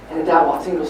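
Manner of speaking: ordinary talk, nothing marked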